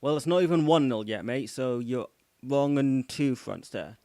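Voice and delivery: loud, slowing firm put-down; loud rebuke. Real, not acted. Streamer telling a chat troll he is wrong, loud firm rebuke. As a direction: shut the troll down hard.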